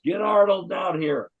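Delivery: high, slow shout up to the loft; retold yell. Director yelling upstairs for Arnold, loud slow call. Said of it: "And he yells".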